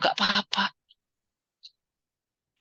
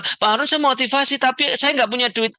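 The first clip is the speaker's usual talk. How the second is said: mock excuse-maker; 11 semitones higher, 4 dB louder than usual. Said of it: "Kalau Anda bilang".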